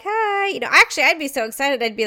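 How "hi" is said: high, awkward little greeting; an awkward high hello on the red carpet. Retold or imitated. Awkward celebrity greeting on the red carpet. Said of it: "I would stand there awkwardly, like".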